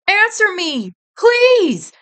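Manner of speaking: high, loud pleading cry; desperate cry. A narrator's reading. Fox begging the nurse for news of Judy, loud desperate plea. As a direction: beg for an answer at full voice. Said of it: "he cried".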